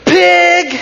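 very high, loud shriek of Pig; yelled insult. Retold or imitated. Fed-up woman yelling back at the driver, loud high shriek. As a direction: hurl it back at him, fed up.

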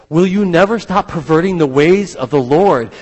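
Paul confronting the sorcerer, loud and accusing; loud rebuke. Retold or imitated. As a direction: hurl the question at him. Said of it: "when Paul calls them out".

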